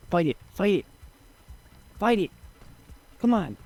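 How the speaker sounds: rally the apes to attack; urging on. Real, not acted.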